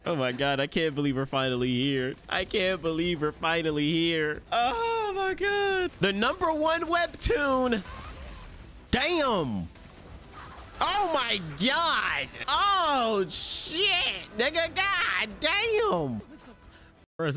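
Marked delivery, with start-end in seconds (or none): 2.3-4.4 drawn-out, emotional disbelief; overwhelmed excitement. Real, not acted. Fan at the long-awaited premiere, slow overwhelmed disbelief. 4.5-16.2 high, stretched-out cries of amazement; awed excitement. Real, not acted. Fan seeing the number one webtoon animated, awed cries.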